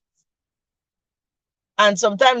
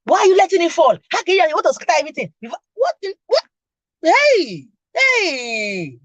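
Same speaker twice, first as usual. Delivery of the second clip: retold scolding; 6 semitones higher than usual. Mother scolding her daughter in public, retold mock yell. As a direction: imitate the mother yelling about dropped things. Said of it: "people are standing there looking at me".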